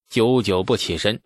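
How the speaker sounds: plain reading voice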